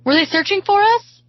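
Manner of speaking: high, loud, excited question; asked excitedly. A narrator's reading. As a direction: ask with rising hope and excitement. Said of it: "I ask excitedly".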